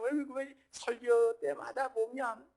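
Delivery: ordinary talk, nothing marked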